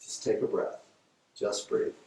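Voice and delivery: soft breathy hush to calm her down; whisper-soft, coaxing her to breathe; calm soothing. Retold or imitated. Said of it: "talking to her in a calm way".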